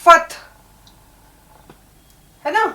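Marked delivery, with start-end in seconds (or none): 0.0-0.3 فتح as one syllable "fat-h", no vowel after t; correct pronunciation. Shown as a demonstration. Teacher shows learners the right word, فتح said "fat-h", one syllable. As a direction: say فتح as "fath", ending on a breathy h. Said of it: "یہ صحیح لفظ ہے".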